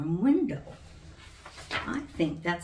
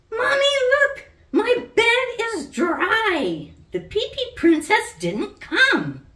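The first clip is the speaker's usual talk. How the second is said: put-on very high, loud, thrilled little girl yelling; joyful shout; 8 dB louder than usual. A narrator's reading. Sally shouts to Mommy that her bed is dry, very high and joyful. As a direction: burst out with happy news. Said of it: "Sally was beaming with happiness".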